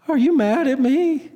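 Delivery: high-pitched imitation of a kid; upset young boy. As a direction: ask like a worried little boy. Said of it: "who would just start crying".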